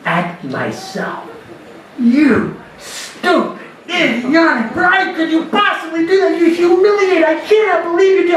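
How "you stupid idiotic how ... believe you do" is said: self-berating rant. Retold or imitated. Outfielder yelling at himself after the error, mock furious. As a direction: chew yourself out, building higher as you go. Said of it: "I start screaming at myself".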